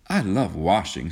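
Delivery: ordinary delivery, nothing marked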